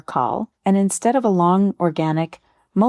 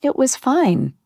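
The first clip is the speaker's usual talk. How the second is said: mildly forced fine, pitched up; pained, hesitant answer; 7 semitones higher, slower than usual. Retold or imitated. Answering a friend about a hard trip, mock hesitant and higher. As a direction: lightly act out a reluctant, higher-pitched answer. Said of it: "hesitation in my voice, the pain in my".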